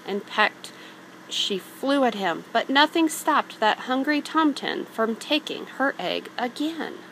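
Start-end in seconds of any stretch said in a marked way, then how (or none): none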